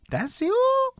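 very high disbelieving question; surprised quote. Retold or imitated. Host retells fans realizing who made the beat, squeaky disbelief.

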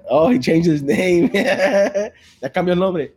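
loud, high, drawn-out laughing. Real, not acted. Teasing co-host about his new name, laughing hard. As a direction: crack up while you say it.

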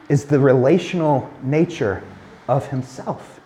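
ordinary delivery

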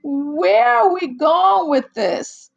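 reacting to the movie, loud slow exasperated question; exasperated outburst. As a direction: demand it in slow outrage. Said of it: "I'm like".

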